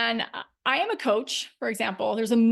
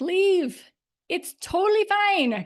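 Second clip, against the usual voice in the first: higher and louder, breezy send-off; cheerful dismissal; 8 semitones higher, 4 dB louder, slower than usual. Retold or imitated. Coach voicing herself to unsubscribers, higher and louder. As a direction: wave them out happily. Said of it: "Actually, I'm like".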